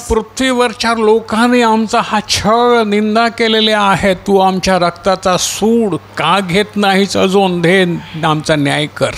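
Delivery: high-pitched plea for vengeance; crying out. Acted. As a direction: plead to God as the wronged martyrs. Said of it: "मोठ्यानं ओरडून काय म्हणाले".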